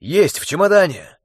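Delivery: shouting. A narrator's reading. Wounded calling answer.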